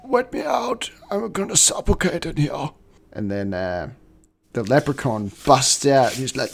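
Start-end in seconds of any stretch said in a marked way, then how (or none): 0.1-2.7 put-on very high, thin little-girl plea; hammed-up ghostly little girl. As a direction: squeak it like a trapped child. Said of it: "the voice of a disembodied little girl".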